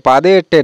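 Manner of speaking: পাদে এট্টে as two words, no n; wrong form পাদে এট্টে; common mispronunciation. Shown as a demonstration. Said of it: "এরকম কেউ কেউ উচ্চারণ করে থাকে".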